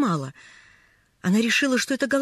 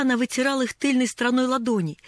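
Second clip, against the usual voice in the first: ordinary delivery, nothing marked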